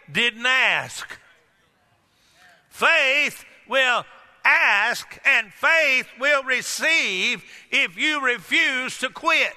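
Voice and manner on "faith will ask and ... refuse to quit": slow, shouted preaching; preaching climax. Acted. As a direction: hammer it home slowly.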